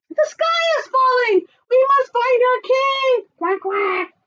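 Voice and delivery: high loud squawking alarm; shouted alarm. Acted.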